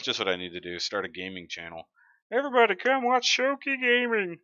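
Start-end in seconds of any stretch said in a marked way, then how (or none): 2.3-4.4 high, loud, squeaky hype voice; put-on high-pitched announcer shout; silly promo voice. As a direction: pitch way up, belt it like a goofy channel promo. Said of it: "Why do I do that voice?".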